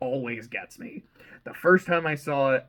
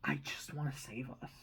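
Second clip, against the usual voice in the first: a hushed, breathy whisper of resolve; 13 dB quieter than usual. Retold or imitated. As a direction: whisper it like a secret vow. Taylor's quiet resolve retold.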